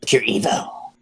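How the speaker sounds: low, dark evil voice. Retold or imitated. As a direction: growl it out pure evil. Demo punchline in a dark voice.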